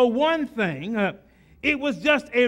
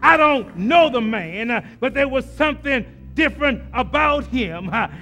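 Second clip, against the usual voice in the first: louder and louder, chanting Simon's account; rising preacher's whoop; 5 dB louder than usual. Acted. Preacher whooping Simon's words to the congregation, growing louder. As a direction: build the sermon to a full whooping climax.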